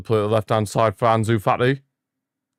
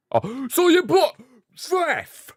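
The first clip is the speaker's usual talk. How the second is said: much higher, stretched gasp of disbelief; shocked outcry; slower than usual. Real, not acted. Gamer shocked at the referee's whistle, high disbelieving outcry. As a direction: yelp at the ref in shock.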